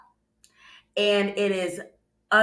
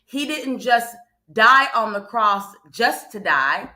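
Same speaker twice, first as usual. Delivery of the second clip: rising preacher fervor; 2 semitones higher, 6 dB louder than usual. Acted. Preaching rises to full force.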